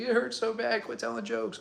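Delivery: high-pitched imitation of wife pleading; mock protest. Wife protests while laughing, retold.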